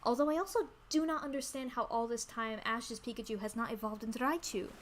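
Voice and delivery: ordinary talk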